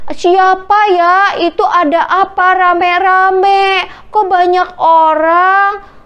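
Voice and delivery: high, slow, drawn-out questions; puzzled questioner. A narrator's reading. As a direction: call out curiously to passers-by. Blind man asks passing crowd.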